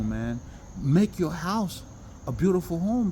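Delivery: normal talking voice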